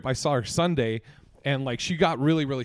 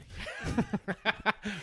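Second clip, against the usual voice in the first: high laugh; 6 semitones higher, 6 dB quieter than usual. Real, not acted. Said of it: "laughing at own joke".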